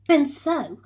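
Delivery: usual speaking voice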